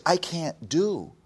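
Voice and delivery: loud, high imitation of her protest; frustrated daughter. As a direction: cry it out as an exasperated child, punching each word. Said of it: "she says".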